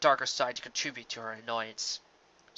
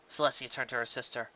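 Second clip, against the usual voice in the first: plain reading voice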